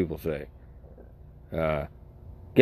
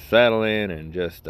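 ordinary talk

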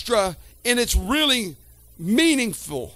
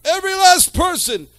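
preaching at full force; 5 semitones higher than usual. Real, not acted. Preacher stirs the congregation about weeping, fervent preaching. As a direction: build the sermon to a fervent peak.

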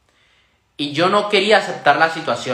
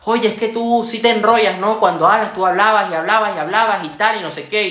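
higher-pitched mocking complaint, rattled off; retold nagging jibe in a raised voice; imitated needling; 5 semitones higher than usual. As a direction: imitate someone picking on you. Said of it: "comienza a meterse conmigo".

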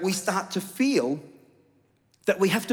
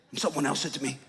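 normal talking voice

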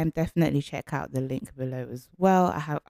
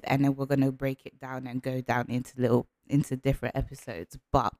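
ordinary delivery

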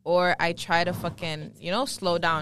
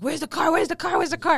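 higher, louder, repeated demand like a panicked father; a hammed-up panicking father shouting; mock angry dad; 9 semitones higher, 5 dB louder than usual. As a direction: imitate the dad yelling at his kid. Dad voiced in a story.

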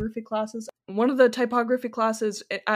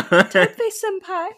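slow, laughing anime senpai call; overacted anime dub; 7 semitones higher, 5 dB louder, slower than usual.

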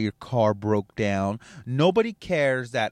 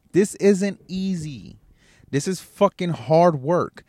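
slow, high, loud punch on hard; slow heavy stress on hard; slow emphatic stress; 5 semitones higher, 5 dB louder, slower than usual. Real, not acted. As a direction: drag out hard. Stressing the hardship.

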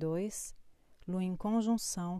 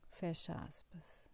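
soft closing cue; dictating; 10 dB quieter, slower than usual. Acted. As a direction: drop it quietly. Dictating quote marks.

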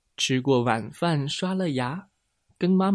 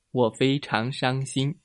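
ordinary delivery

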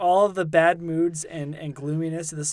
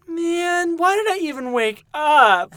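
much higher, louder, slow mock complaint ending in a shout; hammed-up American griping; mocking an American over cold tea, loud and whiny; mock American whine; 10 semitones higher, 7 dB louder, slower than usual. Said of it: "single American wakes up and goes".

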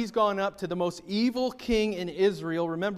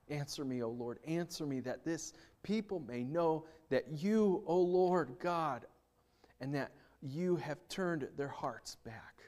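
quiet prayer; 3 semitones lower, 9 dB quieter than usual. Acted. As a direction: pray it intimately, fading into a whisper. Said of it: "came near and said".

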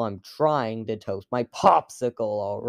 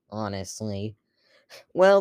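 ordinary delivery

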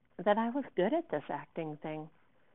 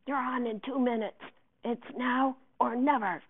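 high, urgent stage call; hush shouting; 6 semitones higher, 4 dB louder than usual. A narrator's reading. Director warning Olivia she's on, hush shouting. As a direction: push it out urgently, half restrained. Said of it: "hush shouting from the door".

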